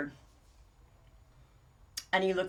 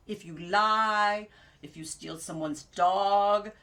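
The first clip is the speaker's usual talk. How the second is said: slow listing; 3 dB louder, much slower than usual. Retold or imitated. Ibrahim listing sins slowly.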